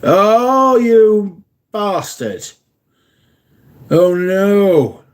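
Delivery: high, loud, drawn-out dismay; dismayed groan. Real, not acted. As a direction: stretch out the bad-news groan. Hearing bad news about shipping.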